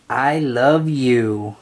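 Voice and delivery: plain reading voice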